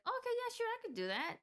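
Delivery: high, chirpy cashier greeting; bright customer-service voice. Retold or imitated. Cashier greeting a customer, high and chirpy. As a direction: put on a cheery service voice. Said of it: "I was like".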